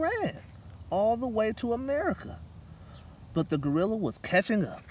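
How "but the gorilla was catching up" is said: ordinary delivery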